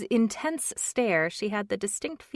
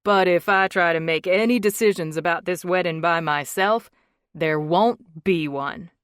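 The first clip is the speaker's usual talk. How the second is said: raised voice, carrying loud; louder; 9 dB louder than usual. A narrator's reading. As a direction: project it so she hears through the wall. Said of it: "Cole increased his volume".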